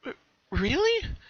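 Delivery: high, slow, stammering; a little unsure. Acted. Bellamina doubting the stranger, unsure. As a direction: hesitate nervously. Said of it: "a little unsure".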